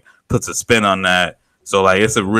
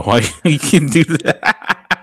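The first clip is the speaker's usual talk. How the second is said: laughing; 5 semitones higher, much slower than usual. Real, not acted. Laughing reaction to impression.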